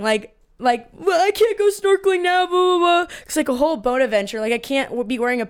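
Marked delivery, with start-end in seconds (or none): 0.9-3.1 mock whiny cry. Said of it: "I was literally crying".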